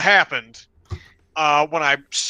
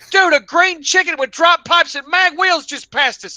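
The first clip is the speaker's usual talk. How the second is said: high, loud excited tripping friend; freaked-out buddy; 13 semitones higher, 4 dB louder than usual. Retold or imitated. Buddy in the car describing a hallucination, high and excited. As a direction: blurt it out like a wide-eyed hallucinating buddy.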